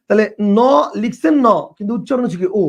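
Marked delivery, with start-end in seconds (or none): none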